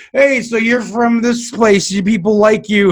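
slow, loud mock-drunk slur; imitated drunk talk. Drunk host to huge industry guests, imitated tipsy drawl. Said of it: "we were just drunk and".

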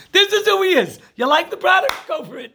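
high, emphatic matter-of-fact advice; blunt advice. Retold or imitated. Matchmaker advising a dating client, high blunt emphasis. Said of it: "Every shadchan tells any".

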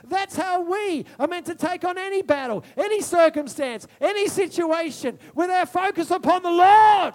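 high, loud ringing sermon voice; full-force preaching. Acted. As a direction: preach it out with fire. Preaching to the congregation.

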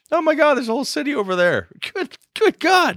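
high, squeaky gasp of mock awe; mock-stunned falsetto delight; mock amazement. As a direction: gush like you just found a lost city. Mock awe at the lost city.

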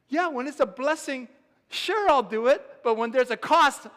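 high, loud over-keen agreement; hammed-up enthusiasm, stretched and loud; mock eagerness. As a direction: play up the eager yes-man. Mocks unwilling man's eager agreement.

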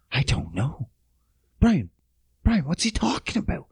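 hushed, breathy aside to Brian; panicked whisper. Retold or imitated. Podcast host whispering to his co-host, panicked and hushed. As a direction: whisper it like you don't want the guest to hear. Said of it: "I'm like".

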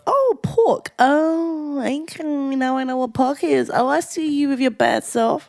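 high, drawn-out aha moment; delighted realisation. Retold or imitated. Acts out recognising a learnt word.

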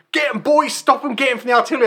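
mock soldier shout. British officer urging troops in the film, mock high yell. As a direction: bark orders like a film officer, pitched high. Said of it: "British people go".

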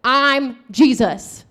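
drawn-out, emphatic answer; slow declaration with weight on each word; slow, deliberate reply. Retold or imitated. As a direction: spell it out slowly for someone hard-headed. Jesus answers the hard-headed believer.

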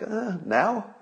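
high, disbelieving mock reaction to the tweet's timing; incredulous now. Host mocking the president's timing, incredulous and high. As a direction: act baffled, pitch climbing on each now. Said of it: "It's like".

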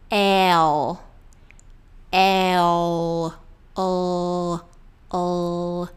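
"L" as a long ell, tongue behind front teeth. Shown as a demonstration. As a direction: hold the dark l at the end of "L". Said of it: "ela sobe pra cima".

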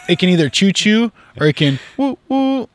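high playful train whistle; train noises. Retold or imitated. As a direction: chug and whistle like a toy train.

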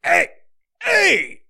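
high-pitched, strained New York hey; mock Guido accent. Mocking animated New Yorkers, strained Guido hey. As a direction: Guido grunt as if the spine is breaking. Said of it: "like their fucking spine is breaking".

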